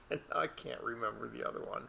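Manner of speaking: ordinary delivery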